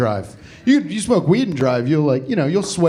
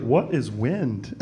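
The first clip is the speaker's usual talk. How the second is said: stoned wonder; 6 semitones lower, 7 dB quieter, much slower than usual. Retold or imitated. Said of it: "I'll be like".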